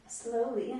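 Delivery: ordinary talk, nothing marked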